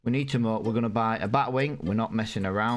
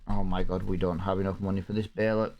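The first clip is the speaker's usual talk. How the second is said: normal talking voice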